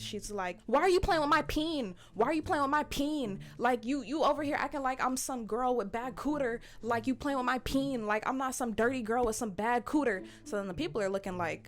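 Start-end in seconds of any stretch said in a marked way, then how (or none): 0.7-10.2 high, loud imitation of an upset woman; retold upset outburst. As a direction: mimic her indignant complaint, pitched up and loud. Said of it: "begins to get very upset".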